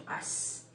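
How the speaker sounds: "as" with a soft, open-ended hiss of S; continuous final S. Shown as a demonstration. Teacher showing why S stays open, S sustained in "as". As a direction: keep the S in "as" running straight on. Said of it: "Dare-diretso".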